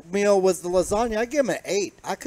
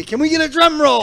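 high, loud hype; hyped call; 8 semitones higher, 5 dB louder than usual. Real, not acted. Brothers hyping the taste test, high and loud. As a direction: fire up the drum roll. Asking for drum roll.

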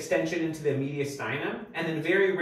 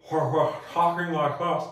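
slow, muffled words as if a hot potato burns the mouth; much slower than usual. Shown as a demonstration. Lecturer showing students the symptom, slow muffled hot potato voice. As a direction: speak slowly around a too-hot bite. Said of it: "is what we call a hot potato voice".

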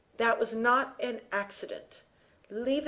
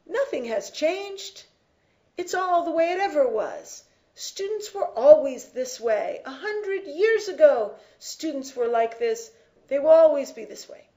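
higher, dismissive voice of a doubter; mock naysayer; 4 semitones higher, 5 dB louder than usual. Teacher mocking those who deny change, dismissive. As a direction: scoff like someone who thinks nothing changes. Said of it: "which means no one can say".

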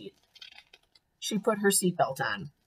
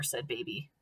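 ordinary delivery, nothing marked

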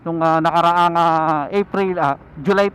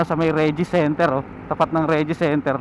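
ordinary talk

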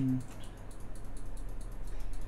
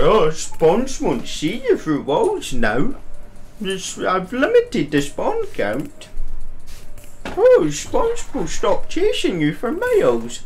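mocking voice; 7 semitones higher than usual. Mocking the game's patch notes.

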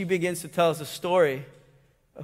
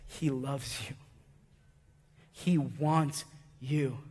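tender; 5 semitones lower, 9 dB quieter, slower than usual. Acted. Tender gospel appeal, soft slow.

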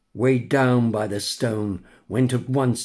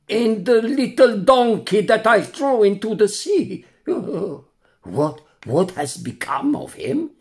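higher and louder, stammering in shock; quivering voice; 9 semitones higher, 5 dB louder than usual. Acted. The stunned buyer asks the puppet where his donkey went, quivering. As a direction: stammer out the question, shaken. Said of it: "he asked in a quivering voice".

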